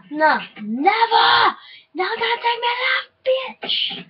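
high, defiant shout of refusal; defiant yell. Real, not acted. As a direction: yell like you're cornered. Defiant yelling at enemies in game.

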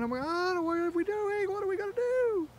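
squealing panic, very high; shrieking jackpot winner. Retold or imitated. Voicing panicked bees, shrieking.

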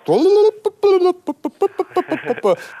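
shrieking laugh at his own joke; high burst of laughter. Real, not acted.

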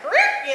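imitated record needle scratch, sharp and high. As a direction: mimic a record screeching to a stop. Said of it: "you hear the record player".

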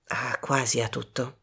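low, soft, smug reply; quietly self-satisfied. A narrator's reading. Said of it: "disse Poirot compiaciuto". (sommessamente compiaciuto)

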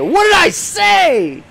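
very high, loud drawn-out wail; dramatic yell. Pretend. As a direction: ham up the outrage. Dramatic cry when hit.

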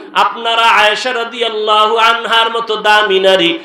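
ordinary talk, nothing marked

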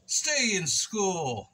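"Stay in school!" dragged very slow; slow slogan. Pretend. Tacking on a mock public service slogan, very slow.

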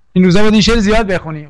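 ordinary talk

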